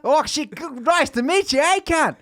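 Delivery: high, loud hammed-up Australian accent; mock Australian accent. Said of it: "they're like".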